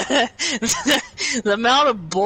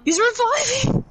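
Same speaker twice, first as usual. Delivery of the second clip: alarmed shout; 14 semitones higher than usual. Real, not acted. Alarmed call during fight.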